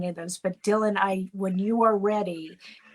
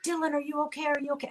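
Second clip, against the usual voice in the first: panicked apology; 8 semitones higher than usual. Retold or imitated. Panicked friend on the phone.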